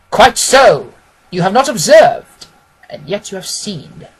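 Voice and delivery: lightly voiced detective driving it home; Holmes voice. A narrator's reading. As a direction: Holmes scoring his point over Watson.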